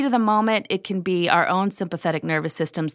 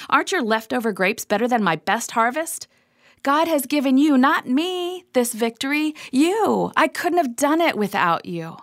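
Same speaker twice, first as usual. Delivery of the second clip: higher, eager praise that lifts on not me; gentle flattering Gideon; 6 semitones higher than usual. Acted. Gideon soothing his angry soldiers with earnest compliments. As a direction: heap credit on them to calm them down.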